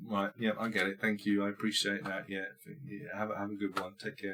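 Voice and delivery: wife leaving quietly, mock courteous; meek wife. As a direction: mumble politely as you give up. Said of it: "then she's like".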